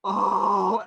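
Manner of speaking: loud, high, drawn-out crowd "oh"; imitated audience "oh". Whole audience after a beat of silence, imitated loud "oh". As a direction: let out a long collective "oh". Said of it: "whole audience just kind of goes".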